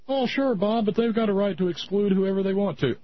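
high put-on objector voice; mock caller's high-pitched protest; objecting listener.

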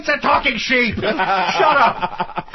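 high, loud and laughing; laughing exasperated shout. Real, not acted. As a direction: blurt it out through laughter. Mock outrage, laughing.

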